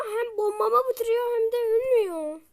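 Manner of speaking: mock sob at the unbeatable boss; whiny fake cry. Real, not acted. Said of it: "Ağlayacağım".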